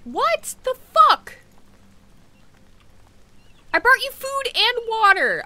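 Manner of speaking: high, loud, slow incredulous cry; shocked disbelief. Real, not acted. Player crying out in disbelief at a sudden death. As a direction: drag out the outrage. Another animal suddenly dies.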